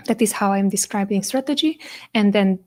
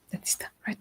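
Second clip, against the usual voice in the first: whispered aside; whispering; 14 dB quieter, faster than usual. Real, not acted. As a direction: whisper the correction to yourself. Self-correction aside, unvoiced.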